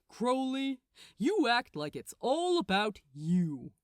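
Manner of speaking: an archangel setting a demon straight; Gabriel, lecturing. Acted. Gabriel lecturing Crowley about Aziraphale, smug.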